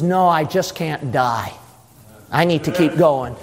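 low, steady refusal; resolute Jesus. Acted. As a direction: answer the tempter low and unmoved. Jesus answers the devil.

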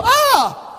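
sudden high yelp of pain, hammed up; a mock cry of pain, sharp and high. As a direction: yelp as if struck by the rod. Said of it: "and then you go".

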